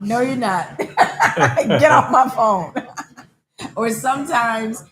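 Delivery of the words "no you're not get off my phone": playful retort that cracks up into laughter; laughing mock retort. Telling friends how she answers scammy call agents, laughing. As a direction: laugh through a louder, drawn-out mock dismissal.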